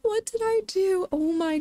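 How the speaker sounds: alarmed cry. Real, not acted. Firework mishap, distressed again.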